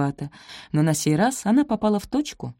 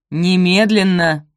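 loud, drawn-out order; angry command; 8 dB louder, slower than usual. A narrator's reading. Stepmother cuts off Sophie's question, commanding. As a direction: cut in and insist loudly.

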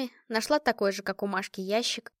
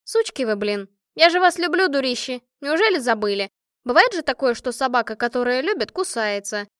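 fervent, heated; 3 semitones higher, 7 dB louder than usual. A narrator's reading. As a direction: declare rough love to your friends at full heat. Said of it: "с жаром сказала Машка". (с жаром, взахлёб)

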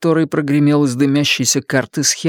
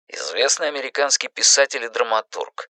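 ordinary delivery

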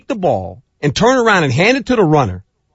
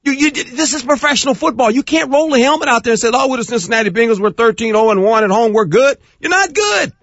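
much higher, fed-up scolding of the players; exasperated rant at the team; 10 semitones higher than usual. Retold or imitated. A coach venting at his flat team, exasperated. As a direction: berate the team like a disgusted coach. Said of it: "I'm going".

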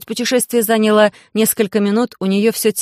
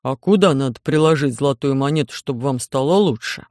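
low, gullible alien voice; trusting giant alien; 5 semitones lower than usual. A narrator's reading. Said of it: "спросил доверчивый Громозека".

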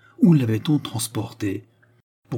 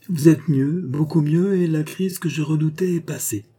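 higher, brighter relief in her voice; relieved nurse; 6 semitones higher than usual. Acted. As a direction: lift the voice with relief.

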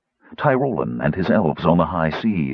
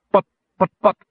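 higher, louder flustered stammer; stuttering gnome; 13 semitones higher, 5 dB louder, faster than usual. A narrator's reading. Gnome stammering when Kitiara scolds him, flustered stutter. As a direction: trip over the word, caught out. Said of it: "stuttered Fitter".

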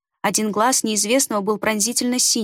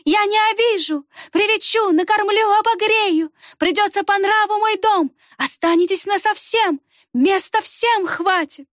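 ringing coaxing voice; 10 semitones higher than usual. A narrator's reading. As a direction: lure the children in sweetly. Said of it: "Голос прозвенел".

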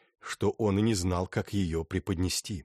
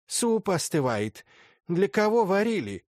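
imperious command; 11 semitones higher, 4 dB louder than usual. Acted. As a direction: order them to eat, haughtily. Princess keeps ordering guests.

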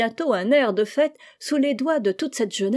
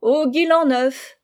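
loud, higher, drawn-out call; slow, raised holiday shout; interrupting cry; 5 semitones higher, 6 dB louder, slower than usual. A narrator's reading. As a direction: cut in with a festive cry. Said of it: "interrompit Mac".

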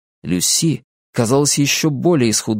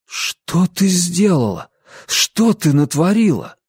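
higher, dismayed questions; aghast exhale; 6 semitones higher, slower than usual. A narrator's reading. Ansel to Lucy who betrayed them, aghast. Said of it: "выдохнул он".

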